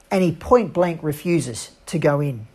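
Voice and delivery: ordinary delivery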